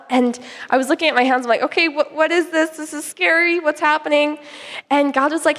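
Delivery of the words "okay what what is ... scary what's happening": higher-pitched, bewildered questioning; mild retold alarm. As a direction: act out being lightly spooked and confused. Retelling alarm at names on hands.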